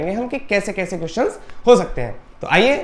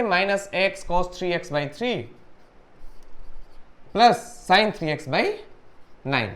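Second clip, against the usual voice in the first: slow dictation; 3 semitones lower, 4 dB quieter, much slower than usual. Shown as a demonstration. Dictating final answer.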